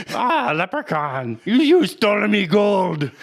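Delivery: high, loud Irish leprechaun growl; mock the movie leprechaun's Irish accent. Friend imitates the leprechaun movie villain, mock Irish accent. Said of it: "the leprechaun movies".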